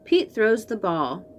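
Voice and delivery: ordinary delivery, nothing marked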